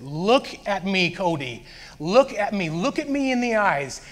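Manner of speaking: high, drawn-out insistent plea; urgent pleading. Retold or imitated. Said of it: "he was pleading with me".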